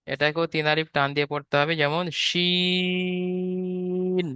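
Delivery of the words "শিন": শিন with a thick sh, drawn very long; thick long shin. Shown as a demonstration. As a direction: hold শিন broad and long. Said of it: "শিনের আওয়াজটি হবে একটু মোটা আওয়াজে". (মোটা আওয়াজে লম্বা টেনে শিন)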